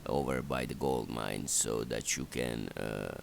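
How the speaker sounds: ordinary delivery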